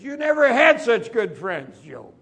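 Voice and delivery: loud, high preaching rebuke. Acted. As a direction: thunder it at him from the pulpit. Preacher rebuking Job's complaint.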